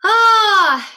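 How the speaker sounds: a high, loud, open "Ah" out of the mouth. Shown as a demonstration. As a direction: open up and send the ah out. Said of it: "getting a sound out and opening up".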